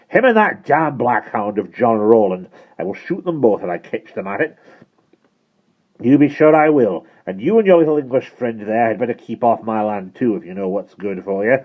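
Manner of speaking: put-on furious, accented farmer's threat; Caradog Pritchard. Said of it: "thickened by rage".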